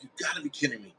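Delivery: soft thought to oneself; hushed incredulous aside; inner disbelief. Retold or imitated. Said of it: "In my head".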